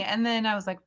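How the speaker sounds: ordinary talk, nothing marked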